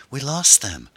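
high, loud cry of triumph; excited exclamation. A narrator's reading. Passenger to driver as pursuers fall behind, exclaiming excitedly. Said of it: "Erin exclaimed".

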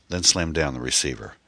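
usual speaking voice

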